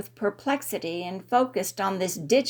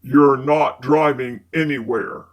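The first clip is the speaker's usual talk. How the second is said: low male character voice; man's voice; 8 semitones lower, 7 dB louder than usual. A narrator's reading. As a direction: voice Fitz low and smooth. Fitz insists.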